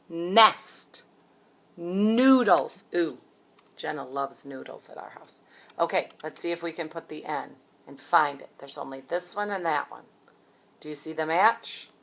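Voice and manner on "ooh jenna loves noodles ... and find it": ordinary talk, nothing marked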